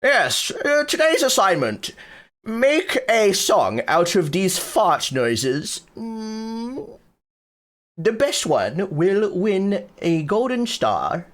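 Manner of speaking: high, slow prissy teacher voice; mock teacher. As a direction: hand out the assignment like a fussy teacher. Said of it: "I should be a music teacher".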